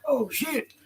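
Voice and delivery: a jumpy high "oh, shit" as if busted; mock startled yelp. Busted guys realising who she is, startled high yelp. Harassers caught out, freaking out.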